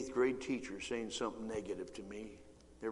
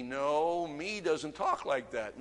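ordinary delivery

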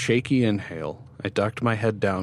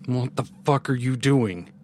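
higher, alarmed demand; mildly shaken outburst; trembling demand; 4 semitones higher than usual. A narrator's reading. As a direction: demand an answer, rattled. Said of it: "his voice trembling".

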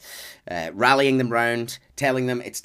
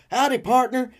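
friendly cowboy greeting; 12 semitones higher, 3 dB louder than usual. Retold or imitated. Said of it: "greet, will go".

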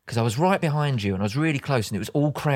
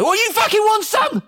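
high, loud mock-aggressive yell; comic fighting-talk shout, pitched way up; explosive challenge; 8 dB louder than usual. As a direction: explode at a stranger, hammed up for laughs. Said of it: "You're just gonna explode on him".

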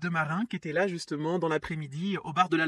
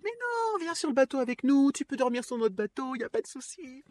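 put-on much higher, sing-song drunken invitation; drunk German woman; 8 semitones higher than usual. The drunk German woman inviting her onto their boat, high and sing-song. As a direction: squeal the invite like a tipsy host. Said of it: "me la joue en mode".

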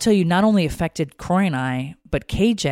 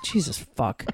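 soft exasperated curse; pissed-off muttering; 5 dB quieter than usual. Retold or imitated. Wife called over just to rehearse lines, pissed-off mutter. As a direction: swear quietly under the breath. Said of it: "Like, I was so pissed".

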